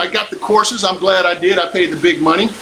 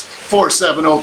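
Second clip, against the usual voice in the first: ordinary delivery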